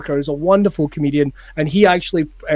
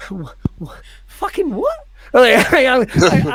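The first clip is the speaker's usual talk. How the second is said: stunned disbelief; 6 semitones higher than usual. Acted. Comic retelling to podcast hosts, stunned disbelief. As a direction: act dumbstruck, words barely getting out. Acted stunned reply to instructor.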